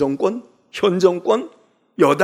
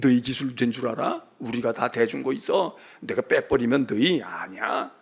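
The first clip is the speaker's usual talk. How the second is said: sneering taunt; 6 semitones lower, 3 dB quieter than usual. Retold or imitated. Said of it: "조롱하잖아요".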